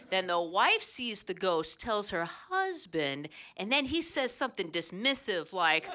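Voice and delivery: ordinary delivery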